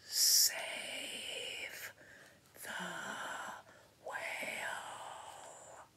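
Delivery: long, drawn-out whisper; slow, breath-only storybook plea; whispered message. A narrator's reading. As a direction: whisper each word separately, with big pauses. Reads snail's silent trail message.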